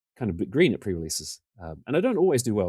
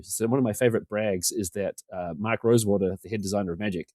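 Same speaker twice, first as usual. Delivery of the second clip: usual speaking voice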